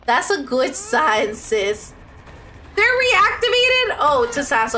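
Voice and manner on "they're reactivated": shocked. Real, not acted. Shocked at game event.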